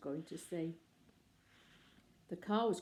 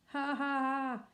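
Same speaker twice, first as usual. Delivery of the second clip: mock laugh; 7 semitones higher, 5 dB louder, slower than usual. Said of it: "cried another photographer".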